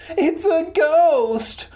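very high, drawn-out squeal; happy exclamation. A narrator's reading. Roxanne delighted to find a ghost, happy high squeal. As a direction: sing it out with gleeful excitement. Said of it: "Roxanne exclaimed happily".

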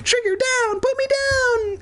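begging mouse voice. A narrator's reading. Boy in dog's jaws begs in squeaky voice. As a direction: plead in a tiny mouse squeak. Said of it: "Evan begged".

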